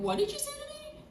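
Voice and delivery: very high, soft mock squeal; inward disbelief. Applicant in her head on hearing of approval, squeaky disbelief. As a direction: squeak it softly, as a thought in disbelief. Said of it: "in my head, I was like".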